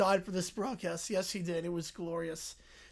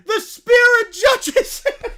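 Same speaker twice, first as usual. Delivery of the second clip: loud, high, slow laughing wail; shrieking laughter; 12 semitones higher, 13 dB louder, slower than usual. Real, not acted. Streamer laughing at the blanket. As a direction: howl it while cracking up.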